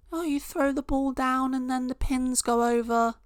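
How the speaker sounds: high mock-impressed voice, sarcastic. Vlogger mocking bowling as a sport, in a high sarcastic voice. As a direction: squeal it out like it's thrilling.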